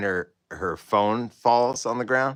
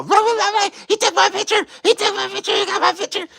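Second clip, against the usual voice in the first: very high, louder squawking complaint; high-pitched mocked angry woman yelling; mock clucking rant; 4 dB louder, faster than usual. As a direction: squawk it like a hysterical hen. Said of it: "starts clucking".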